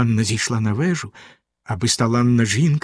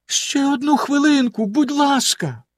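high pleading wife; 13 semitones higher than usual. A narrator's reading. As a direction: beg for one more minute. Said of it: "попросила його дружина". (благально, тонким голосом)

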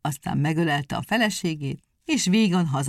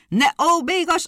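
higher, louder snapping at wife; shouted; 11 semitones higher, 7 dB louder than usual. A narrator's reading. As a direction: shout her down. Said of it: "rákiáltott a feleségére".

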